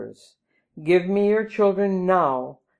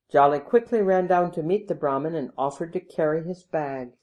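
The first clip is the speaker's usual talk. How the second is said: plain reading voice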